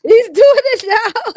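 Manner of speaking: high, loud, drawn-out mimicry; mocking echo. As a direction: parrot it back high and slow, teasing. Echoes the other's put-on voice, teasing.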